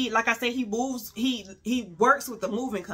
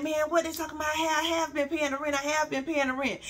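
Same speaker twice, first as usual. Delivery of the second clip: a hint of a defensive boyfriend, higher; voicing the boyfriend; 7 semitones higher, faster than usual. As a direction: lightly play him protesting, raised pitch. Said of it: "He like".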